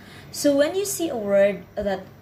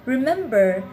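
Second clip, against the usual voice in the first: ordinary talk, nothing marked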